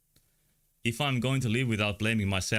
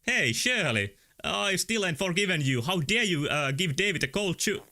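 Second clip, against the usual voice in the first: scolding in character; 7 semitones higher than usual. Acted.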